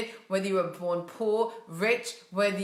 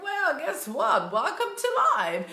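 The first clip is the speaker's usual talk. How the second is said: sarcastic retort; 6 semitones higher than usual. Retold or imitated. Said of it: "I'm like".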